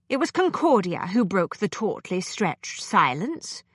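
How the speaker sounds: ordinary delivery, nothing marked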